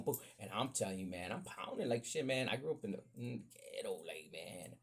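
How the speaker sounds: ordinary talk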